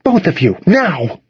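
stern order, pitch climbing on the last word; firm, high-pitched teacher's demand. A narrator's reading. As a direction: command it like a fed-up teacher. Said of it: "Missus Levine demanded".

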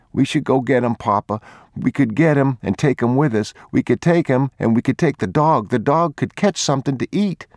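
put-on a little boy begging his father to rescue another child; pleading boy. A narrator's reading. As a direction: plead with Papa like a young boy.

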